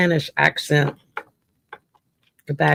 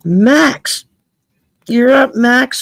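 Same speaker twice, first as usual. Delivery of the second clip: higher, louder call to the next sitter; 7 semitones higher, 6 dB louder, slower than usual. Real, not acted. Reader calling Max up next for a reading, loud. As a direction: call Max up over the room.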